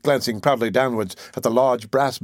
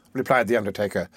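plain reading voice

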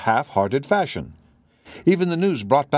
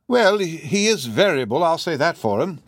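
deferential housekeeper in easy gossip; chatty housekeeper; 6 semitones higher than usual. Acted. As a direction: chat respectfully as a talkative housekeeper. Voices the housekeeper in story.

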